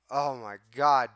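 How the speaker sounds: ordinary talk, nothing marked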